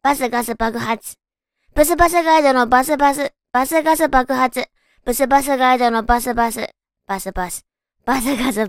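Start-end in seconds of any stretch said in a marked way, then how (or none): 1.7-4.7 fast tongue twister. Shown as a demonstration. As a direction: rattle off the twister. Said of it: "早口言葉にチャレンジ".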